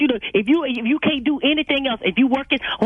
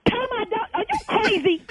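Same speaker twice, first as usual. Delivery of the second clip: shocked disbelief; 9 semitones higher than usual. Real, not acted. Shocked owner hears dog taken.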